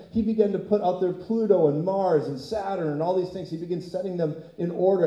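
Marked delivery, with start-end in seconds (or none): none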